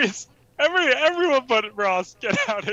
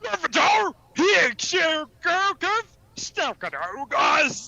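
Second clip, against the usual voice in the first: garbled mock-Cockney gibberish; incomprehensibly thick Cockney; 5 semitones higher than usual. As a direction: mangle every word in overdone Cockney. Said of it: "so thick we don't know what you're saying".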